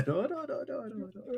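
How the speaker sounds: high rapid protest; panic-stop the line; alarmed NPC. Acted. Scientist alarmed, rapid high protest.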